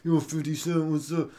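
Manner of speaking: mock gritted-teeth rapper. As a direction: say it with teeth clenched. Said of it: "like he was gritting his teeth".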